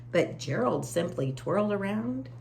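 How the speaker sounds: ordinary delivery, nothing marked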